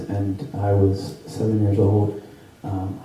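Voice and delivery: ordinary talk, nothing marked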